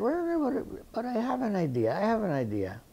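retold excited change of mind; imitated sudden idea. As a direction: jump up high as if interrupting yourself with an idea. Retold Menotti suddenly gets an idea.

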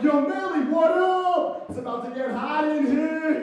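loud, high hyped-up shout; excited eight-year-old. Acted. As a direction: yell it like a thrilled kid greeting his buddy. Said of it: "that's how I talk when I was eight years old".

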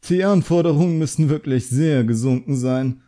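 mild mock disdain; condescending sneer. A narrator's reading. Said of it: "Er sah so herablassend aus".

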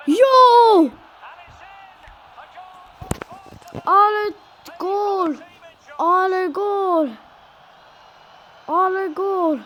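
high, loud goal shout; excited. Real, not acted. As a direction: celebrate the goal at the top of your voice. Goal scored, celebrating.

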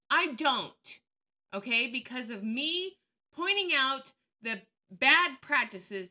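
loud, defiant retort. Real, not acted. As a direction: push back hard.

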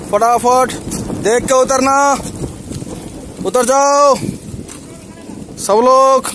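real urgent trail call. Real, not acted. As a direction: call down to the group to keep moving. Calls group to climb down quickly.

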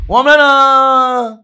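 loud, slow, drawn-out stop; mock dramatic halt. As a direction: freeze everyone with a slow shout. Comic interrupts a clip to react.

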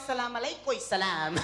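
mock solemn greeting. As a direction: sink deep on the closing salaam. Acts out his reply to greeters.

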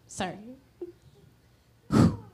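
usual speaking voice

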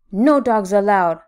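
loud, grumpy prohibition; yelling. A narrator's reading. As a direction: bark it out as a warning. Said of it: "Hank yelled".

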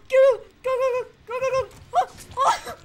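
frantic go go go; excited shouting. Real, not acted. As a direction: scream them on to run. Urging survivor to escape chase.

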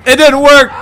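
high, very loud outburst; excited shout. Real, not acted. Gamer reacting as the dramaturgy play fails, excited shout.

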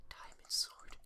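whispered in reverence; awed whisper. Real, not acted. Player gazing at his new sword, awed whisper. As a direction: breathe the words in awe.

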